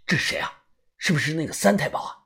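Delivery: tense, hushed and hurried; nervous whisper. A narrator's reading. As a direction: whisper it fast, afraid of the dark. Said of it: "我紧张地问".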